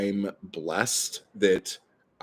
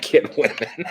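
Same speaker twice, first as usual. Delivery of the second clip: laughing; 8 semitones higher than usual. Real, not acted. Laughs at chat comment.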